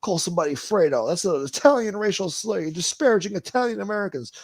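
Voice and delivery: high, loud mock outrage; indignant Cuomo. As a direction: whine like an offended anchor. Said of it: "He's like".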